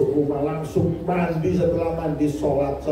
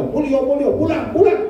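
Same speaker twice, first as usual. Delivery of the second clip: mother shooing her son home in a high mock voice; shrill mother; 11 semitones higher than usual. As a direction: shoo him home in a high shriek. Mother voiced chasing son away.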